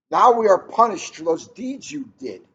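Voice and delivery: loud anguished reproach; crying out. Acted. Wife cries out at her husband after losing their son, loud anguish. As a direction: throw the blame at him loudly. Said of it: "she cried".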